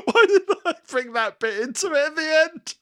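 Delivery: laughing through high pitch. Real, not acted. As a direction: still giggling. Laughing at own joke gone wrong.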